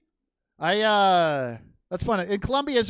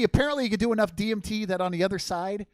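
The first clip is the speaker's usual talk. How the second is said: normal talking voice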